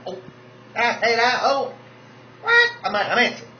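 high, loud mock gasp of surprise; mock shocked diva. Drag mother pretending she didn't insult anyone, mock shocked. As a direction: play innocent, shrill and shocked. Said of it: "That's always Monica".

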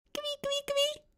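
very high, tiny squeaky voice; tickle squeak. A narrator's reading. Dad teasing son before tickling, squeaky.

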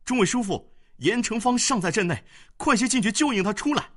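very high, loud, fast call; urgent shout. A narrator's reading. Young general calling his elders back into battle, urgent. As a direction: yell for help urgently. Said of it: "大叫道".

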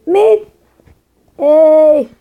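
sudden loud yelp; loud outburst. Real, not acted. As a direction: blurt it out loudly.

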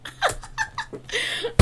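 squeaky giggle; high breathy laugh. Real, not acted. Laughing at dance.

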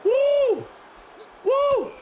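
high, loud "woo"; piercing high-pitched whoop; excited whoop. Real, not acted. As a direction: whoop high over the action. Player whoops during fight.

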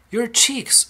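very high cry of alarm; mock scream. A narrator's reading. Mother seeing Violet's cheeks, screaming. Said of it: "screamed Missus Beauregard".

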